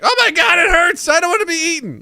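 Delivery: high, loud shout of pain; panicked food. Pretend. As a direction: scream as if being bitten. Sausage Party food in pain.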